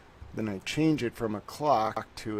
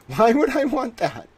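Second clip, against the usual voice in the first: exasperated squeal; 13 semitones higher, 12 dB louder than usual. Real, not acted. Fighting the timer app, exasperated.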